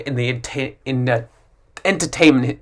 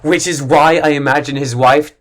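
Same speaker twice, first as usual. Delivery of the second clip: ordinary talk, nothing marked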